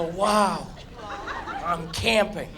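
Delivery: soft, low mock wonder; deadpan tiny wonder; mock awe. Spoiled guest at late room service, soft and low. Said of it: "I go".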